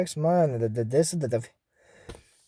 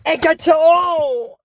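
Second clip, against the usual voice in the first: long, high, loud battle cry; drawn-out cartoon attack call; shouted spell; 8 semitones higher, 5 dB louder, much slower than usual. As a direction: stretch the spell into a big yell.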